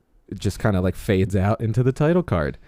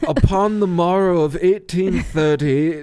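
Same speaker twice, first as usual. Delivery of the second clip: high, slow, mock period narrator; old-fashioned proper speech; 7 semitones higher, slower than usual. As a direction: ham it up like an 1800s orator. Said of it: "Do we have to talk like they do in the movie? All proper?".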